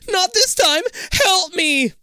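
very high, loud desperate plea; terrified scream. A narrator's reading. Jake pleads from the darkness, terrified scream. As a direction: scream for rescue in terror. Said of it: "Jake's voice sounds so terrified".

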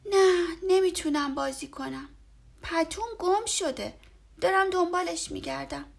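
put-on high, loud sad child voice; a boy too worried to play; upset little boy. A narrator's reading. Boy tells Fox he must search, upset. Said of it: "با ناراحتی گفت".

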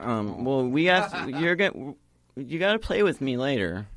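put-on high, pleading child voice; little girl voice. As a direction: beg to keep playing.

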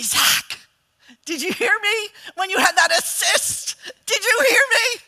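high, loud, eager asking; eager mom. Retold or imitated. Mom asking son in the van after the game, eager. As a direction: beg for credit, delighted. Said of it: "and I would say".

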